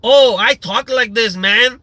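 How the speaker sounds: mock gang member. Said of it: "the guy's gonna look at it and go".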